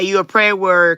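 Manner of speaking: ordinary talk, nothing marked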